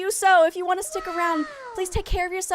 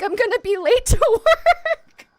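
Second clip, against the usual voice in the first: slower, voice rising into a laugh; breaking into laughter; 6 semitones higher, 5 dB louder than usual. Real, not acted. Telling the head teacher about the dog bite, breaking up laughing. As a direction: laugh through the last word. Laughs retelling her call.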